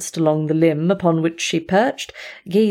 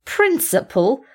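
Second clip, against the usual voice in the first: slow, higher scornful Principle?; mocking question; 6 semitones higher, slower than usual. Speckle repeats the word in a slow scornful question. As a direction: toss the word back incredulously. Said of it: "he sneered".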